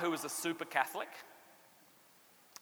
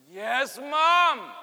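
put-on higher, louder little boy's answer; a dutiful kid says yes; meek kid reply; 5 semitones higher, 8 dB louder than usual. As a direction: son answers his mum, higher and louder. Boy answering his mum.

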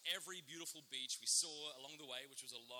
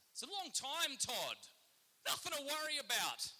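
high, loud mock confidence; over-hearty reassurance; 11 semitones higher, 8 dB louder than usual. Man reassuring his nervous friend about sharks, loud and over-hearty. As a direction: boom it out to cover the worry.

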